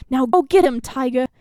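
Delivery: loud, punchy coach cheer; coach's send-off. A narrator's reading. Coach urging Avery back to goal, loud rallying voice. Coach sending player off, accent.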